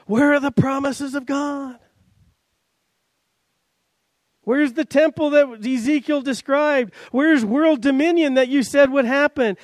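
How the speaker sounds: pitched high, pleading why questions at God; exasperated questioning. Retold or imitated. Preacher voicing the doubting returned exiles, exasperated and high. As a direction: voice the doubters' frustration, rising. People doubting God's promises.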